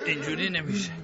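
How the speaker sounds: ordinary delivery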